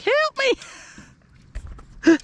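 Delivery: pinky bait voice. Pretend. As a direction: squeak it out like a little lure begging. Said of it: "my pinky's like".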